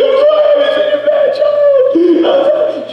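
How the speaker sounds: put-on a sobbing child begging forgiveness; tearful apology. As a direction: daughter weeping to mom on the phone. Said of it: "And I started crying".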